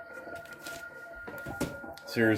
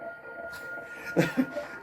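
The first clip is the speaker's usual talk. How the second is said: a sudden laugh, much higher in pitch; 7 dB quieter than usual. Real, not acted. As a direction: burst out laughing way up high at the ugly cover. Laughing at an awful cover.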